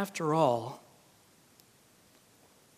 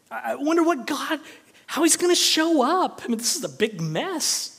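higher, eager wondering; with a smile; 8 semitones higher, 5 dB louder than usual. Pretend. Pastor voicing the hopeful believer, smiling and eager. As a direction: wonder aloud with a smile, full of anticipation. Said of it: "with a smile on our faces".

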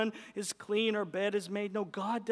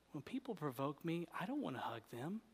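normal talking voice

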